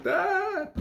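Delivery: high mock daughter's call, tearful; crying daughter. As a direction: imitate the girl calling for her dad.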